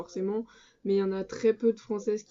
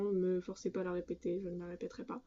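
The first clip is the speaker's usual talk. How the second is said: much softer, under the breath; quiet refusal; 9 dB quieter, faster than usual. Real, not acted. Vlogger refuses viewers a repeat, very quietly. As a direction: drop right down for a small, quiet refusal.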